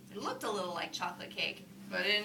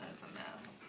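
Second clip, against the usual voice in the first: whispering; 11 dB quieter than usual. Real, not acted. Whispered aside to listener.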